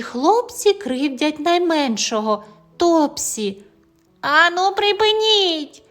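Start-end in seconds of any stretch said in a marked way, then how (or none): 4.2-5.8 shout. A narrator's reading. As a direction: stand up and order them to stop. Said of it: "кричить Тім".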